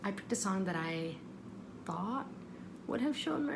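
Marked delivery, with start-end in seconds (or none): none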